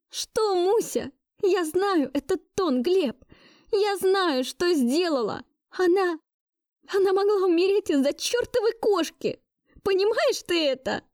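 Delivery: very high, anguished, near tears; hysterical outburst. Acted. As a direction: lash out on the edge of tears. Hysterical outburst before breaking into tears. (истерично, высоко, на грани слёз)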